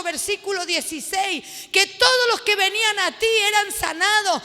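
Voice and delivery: loud, pleading healing prayer; fervent prayer. Shown as a demonstration. Pastor showing how to pray over the sick, fervent and loud. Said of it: "Dígale".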